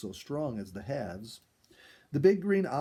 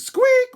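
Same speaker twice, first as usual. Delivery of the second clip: very high, loud raccoon squeak; startled squeak; 18 dB louder than usual. A narrator's reading. The raccoon startled into a backflip, loud high squeak. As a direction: squeak like a frightened coon. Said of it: "went Looney Coon".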